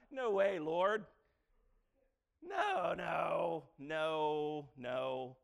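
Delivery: loud protesting refusals, the first no shouted high; Peter refusing. Acted. Peter shouts his refusal to God, loud and protesting. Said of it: "what does Peter say?".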